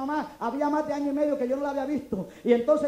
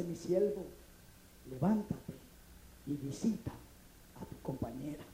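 much lower and softer, slow and hushed; voice of God; 10 semitones lower, 11 dB quieter, slower than usual. Retold or imitated. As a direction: speak as God in a deep hushed voice. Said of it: "el Señor me dice".

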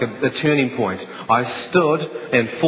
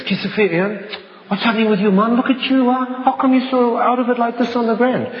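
alarmed friend; 10 semitones higher than usual. Retold or imitated. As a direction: shout it out like a friend who just found you hurt. Friend finds him collapsed, alarmed.

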